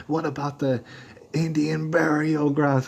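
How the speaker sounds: high, drawn-out mocking whine; emotional guy. Emotional guy objecting about burial grounds, high slow mocking whine. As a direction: lampoon his emotional protest. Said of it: "who got emotional".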